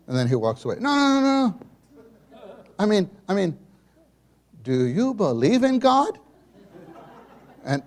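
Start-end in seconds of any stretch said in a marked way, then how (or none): 0.8-6.2 high, loud imitated insistence; mother imitated, high and loud; pressing mother. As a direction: stop him and press.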